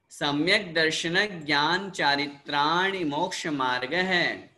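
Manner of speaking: sutra recitation. Shown as a demonstration. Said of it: "जैसे जैसे मैं यहाँ से बोल रहा हूँ".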